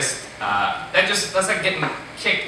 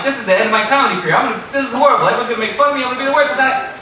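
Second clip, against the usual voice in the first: higher, louder panicked rush; freaking out; 11 semitones higher, 5 dB louder, much faster than usual. Retold or imitated. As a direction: spiral into panic, fast at the start. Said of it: "and I'm freaking out".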